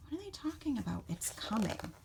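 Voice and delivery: softly whispered aside to the listeners; hushed wondering. Real, not acted. Reader wondering aloud to young listeners, hushed. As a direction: drop to a near whisper, puzzling it over.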